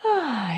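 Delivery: audible sigh out through the mouth; demonstrated sigh. Shown as a demonstration. Teacher models the last breath for listeners, exhaling sigh. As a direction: breathe out on a relieved 'ah'. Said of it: "soupirez par la bouche".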